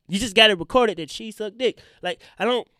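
normal talking voice

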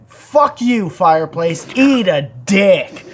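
loud, drawn-out curse; hammed-up angry shout-out at an object; mock shout-out. Shown as a demonstration. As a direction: punch out each insult loud and slow. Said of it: "this is what that sounds like".

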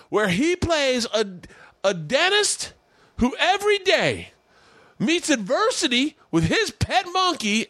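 very high, slow, overblown coach praise; inspirational coach speech. Retold or imitated. Coach describing the TV show to the team, very high and slow. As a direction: ham up the coach's grand tribute.

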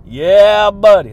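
loud, punchy yeah buddy; big hype shout to the listeners. Real, not acted. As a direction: belt it out like a hype man.

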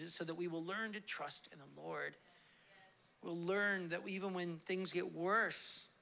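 normal talking voice

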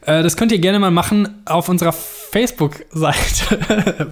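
ordinary delivery

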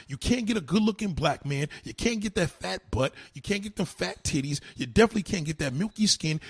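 usual speaking voice

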